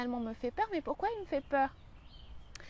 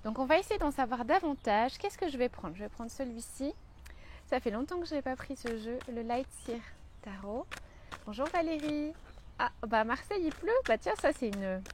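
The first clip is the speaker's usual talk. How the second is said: usual speaking voice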